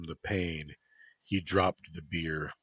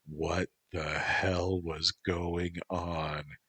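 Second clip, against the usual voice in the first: ordinary delivery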